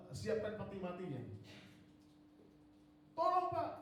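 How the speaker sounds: high, loud panicked plea over the phone; pleading in a panic, voice up high; frantic caller. Retold or imitated. Hospital caller begging the pastor to come, panicked.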